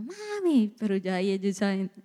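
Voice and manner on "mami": high, drawn-out imitation of a kid; child calling mom. Kids come to mom's room early, imitated high child call. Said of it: "vienen mis hijos a veces temprano".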